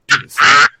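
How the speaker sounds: a hammed-up old mentor pushing on the boy; old man urging. The old man handing over the knife, urging.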